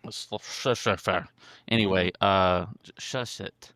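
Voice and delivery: tongue-tied stutter on "Shusett". Real, not acted. Host failing at a writer's name, stumbling drunkenly over "Shusett". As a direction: trip repeatedly on the sh of "Shusett". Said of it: "You sound drunk before you're drunk".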